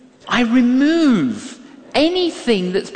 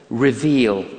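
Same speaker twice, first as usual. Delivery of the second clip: usual speaking voice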